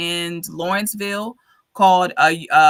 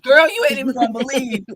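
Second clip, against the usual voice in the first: gossipy; 4 semitones higher than usual. Retold or imitated. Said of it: "I probably called Brianna".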